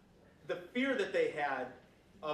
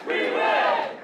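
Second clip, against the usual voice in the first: shouted rally cry, much higher; rally speech; 12 dB louder than usual. Real, not acted. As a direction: belt it out to the crowd. Opens rally with crowd chant.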